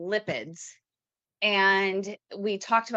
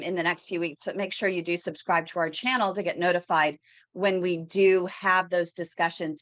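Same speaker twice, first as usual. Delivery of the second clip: usual speaking voice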